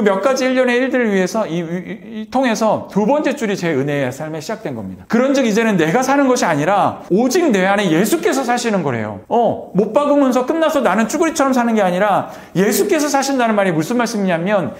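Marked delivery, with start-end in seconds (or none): 5.1-14.7 higher, driving sermon delivery; raised, emphatic preacher's voice; impassioned preaching. Real, not acted. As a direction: proclaim it with conviction to the congregation. Preaches the verse with force.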